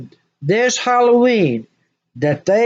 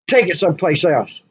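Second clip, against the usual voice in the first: normal talking voice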